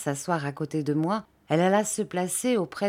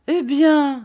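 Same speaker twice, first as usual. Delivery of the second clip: high, loud comeback; retort; 9 semitones higher, 9 dB louder than usual. A narrator's reading. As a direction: fire back with a raised voice. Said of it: "riposta monsieur Peggotty". (riposte vive et sonore)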